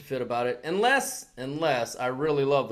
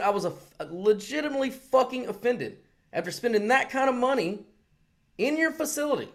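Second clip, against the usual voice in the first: higher, climbing as the grievance builds; retold indignant rant; 8 semitones higher than usual. Ranting at the manager about his money, angry. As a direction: let the outrage rise with each line.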